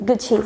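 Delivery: Gucci as 'goo-chee', for repeating; correct pronunciation. Shown as a demonstration. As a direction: model Gucci with a clear 'ch'. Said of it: "Repeat after me". (Gucciનો સાચો ઉચ્ચાર, 'ગુચી')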